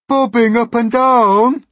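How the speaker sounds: high, loud, drawn-out alarm; slow, shrieked crowd echo; crying out. A narrator's reading. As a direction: shout it back in disbelief. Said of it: "they cried".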